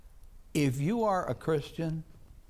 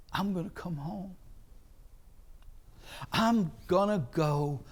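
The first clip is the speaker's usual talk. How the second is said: quiet resolve; 2 semitones higher, 6 dB quieter than usual. Acted. Son resolving to go home.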